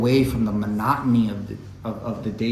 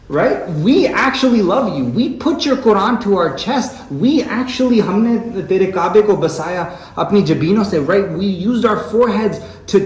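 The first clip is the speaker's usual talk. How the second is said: Iqbal's complaint; 7 semitones higher, 6 dB louder than usual. Retold or imitated. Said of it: "Allama Iqbal is saying, it's like".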